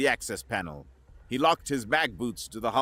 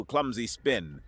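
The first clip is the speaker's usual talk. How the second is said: ordinary delivery, nothing marked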